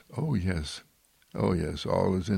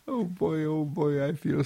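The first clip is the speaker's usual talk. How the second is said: excited puppy; 8 semitones higher than usual. Pretend. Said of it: "do you wag your tail and do you say".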